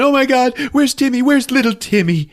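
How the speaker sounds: high-pitched frantic wail; mock crying woman. Woman searching for little Timmy, mock crying. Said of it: "The woman starts crying".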